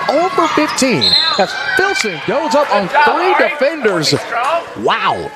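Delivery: high-pitched, amazed game call; real sportscaster excitement; excited play-by-play. Real, not acted. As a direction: call it in disbelief, voice way up.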